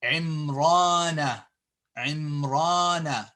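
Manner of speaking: Imrana with a long held ra; Imrana, slow on "raa"; long vowel. Shown as a demonstration. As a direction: draw out the middle a of Imrana. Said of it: "It's Imrana".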